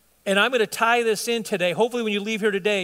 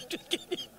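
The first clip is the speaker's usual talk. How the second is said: laughing whisper; 10 semitones higher, 11 dB quieter than usual. Real, not acted. Joking at Bob, laughing.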